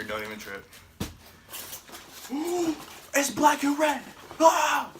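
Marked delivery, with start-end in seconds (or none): 2.3-4.9 high, loud delighted squeal; excited squeal. Real, not acted. Excited unboxing reveal.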